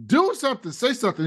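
pushy boss egging them on, hammed up; imitating Logan. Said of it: "Logan was like".

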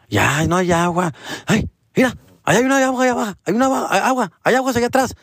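put-on high, lilting old woman pointing the way; mock sweet old lady telling him where the water is. Voicing the old lady's answer.

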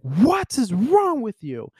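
high, drawn-out, appalled outcry; mock appalled. Movie fan acting the dad refusing her advances, mock appalled. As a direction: stretch it out high in exaggerated disbelief. Acts dad rejecting the girl.

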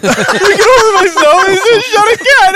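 very high, loud squealing babble; hammed-up shrieking meltdown; acted hysteria. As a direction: lose it completely in a comic falsetto panic. Acting hysteria after the shooting.

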